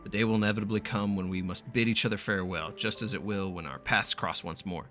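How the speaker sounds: ordinary talk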